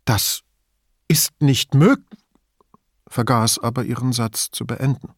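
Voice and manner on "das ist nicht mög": halting, slow disbelief, rising and breaking off; ungläubig. A narrator's reading. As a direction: trail into stunned disbelief. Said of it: "eher ungläubig als verneinend". (ungläubig stockend)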